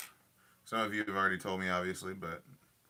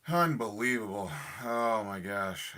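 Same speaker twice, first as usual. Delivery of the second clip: exasperated outburst; 7 dB louder than usual. Real, not acted. Tool breaks while streaming.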